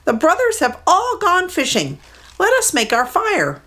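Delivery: mud hen. Acted. Mud hen counting the brothers, high bird voice. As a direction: voice a small eager bird. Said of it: "he heard a mud hen say".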